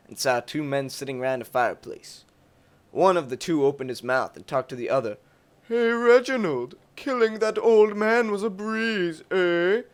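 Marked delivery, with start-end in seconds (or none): 5.7-9.8 higher, louder thug voice, slow and smug; bragging bandit. Acted. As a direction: gloat to your buddy by the fire. Bandit boasting at campfire.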